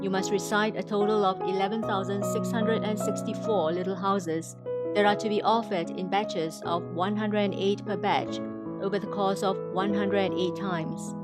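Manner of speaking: ordinary delivery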